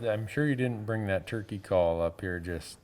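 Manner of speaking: usual speaking voice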